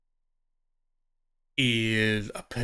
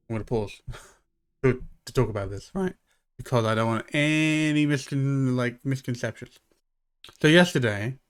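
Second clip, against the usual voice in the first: ordinary delivery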